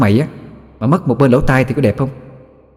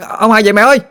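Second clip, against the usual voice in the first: much higher, louder repeat of the call; louder shout; 12 semitones higher than usual. Acted. Boy shouts again for his mother, higher and louder. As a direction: yell it again, higher and louder, rising at the end. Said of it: "la làng la sớm lên".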